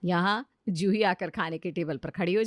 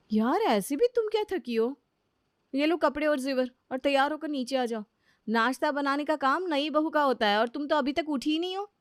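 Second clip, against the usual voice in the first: lightly higher, brisk sister-in-law voice; elder sister-in-law; 6 semitones higher than usual. Acted. Elder sister-in-law hurrying the new bride downstairs. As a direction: chide the new bride, half-teasing.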